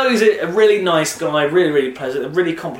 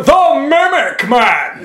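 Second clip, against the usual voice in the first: booming announcer shout, pitched high and stretched; Peter Dixon; 12 semitones higher, 8 dB louder, much slower than usual. Retold or imitated. People walking up to the comedians, shouted announcer impression. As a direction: bellow it like a talent-show voice-over. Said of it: "it is just shouting at you".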